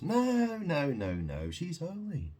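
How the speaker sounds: a sneering imitation of a man defending her; mocking protest. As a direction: yelp the no's like a fooled man. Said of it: "But man goes".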